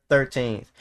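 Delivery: normal talking voice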